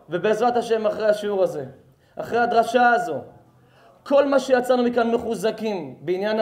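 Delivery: preaching exhortation. Real, not acted. Preacher blessing the congregation after the lesson, forceful exhortation. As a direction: press each phrase on the congregation.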